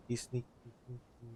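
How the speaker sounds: hushed sneaky whisper. Real, not acted. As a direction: murmur it like sneaking up. Sneaking up on Ito.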